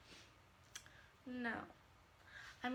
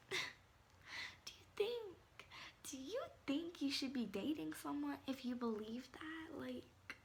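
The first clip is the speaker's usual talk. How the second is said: higher, hushed whisper to the viewers; 7 semitones higher, slower than usual. Real, not acted. Vlogger whispering a question to viewers. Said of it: "whispered aside".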